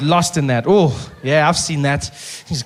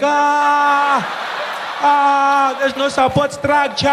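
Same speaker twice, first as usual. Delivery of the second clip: panicking swimsuit; 7 semitones higher, much slower than usual. Acted. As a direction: play a swimsuit in a fright. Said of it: "the costume is panicking".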